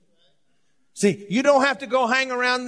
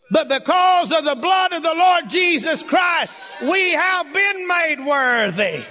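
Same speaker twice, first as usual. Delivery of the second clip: full-force preaching; 6 semitones higher than usual. Acted. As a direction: shout the good news to the congregation.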